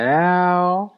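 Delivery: hammed-up rapper's call. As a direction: throw out a playful catchphrase yelp. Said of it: "I like to do my [name] voice".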